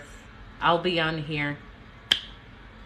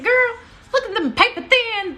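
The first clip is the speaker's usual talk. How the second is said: high, loud sassy mom impression, much higher than her own voice; imitating mom; 10 dB louder than usual. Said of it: "this is really how she talks".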